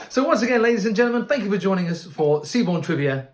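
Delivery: big bright showman welcome; announcer voice. Acted. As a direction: boom it out like a cruise host. Cruise host welcomes guests again.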